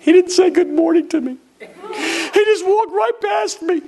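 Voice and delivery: high, mock-whiny complaint; wounded churchgoer. Mimics a wounded church member.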